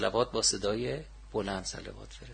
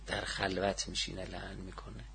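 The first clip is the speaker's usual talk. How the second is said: low, much softer delivery; hushed repeat; 5 semitones lower, 12 dB quieter than usual. Real, not acted. Teacher repeating the narration about cursing in seclusion, hushed. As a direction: drop the voice right down, confidingly.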